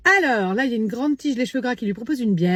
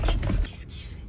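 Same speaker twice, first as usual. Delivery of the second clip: whisper; 6 dB quieter, much slower than usual. Acted. Hiding while spying.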